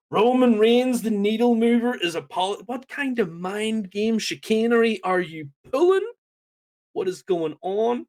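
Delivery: a fan floored that Roman apologized; stunned disbelief. Real, not acted. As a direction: sound floored by what you just saw.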